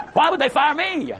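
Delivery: high, slow, drawn-out hammed-up whine; hammed-up bewildered employee asking why her; mock indignation. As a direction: mimic her baffled self-pity. Replaying her indignant question.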